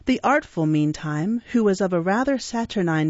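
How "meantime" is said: usual speaking voice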